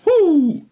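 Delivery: loud, high "woo"; high whoop. Real, not acted. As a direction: let out a quick loud whoop. Reacting to heated comment thread.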